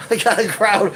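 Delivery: high giggling repeat; laughing. Real, not acted. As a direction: laugh the line out. Same words, now laughing.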